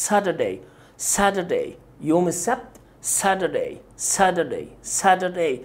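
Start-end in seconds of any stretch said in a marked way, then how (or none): none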